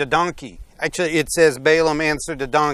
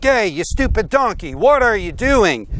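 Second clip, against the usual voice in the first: raised, high-pitched mock scolding; angry scolding; 7 semitones higher, 5 dB louder than usual. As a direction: yell at a stupid animal, acted out. Balaam scolds his donkey in the retelling.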